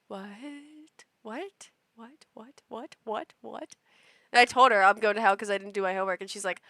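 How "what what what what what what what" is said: soft, breathless repeated whats; stunned mom. Retold or imitated. Mom hearing her child got told he is going to hell, stunned and soft. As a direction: let the words fall away in shock.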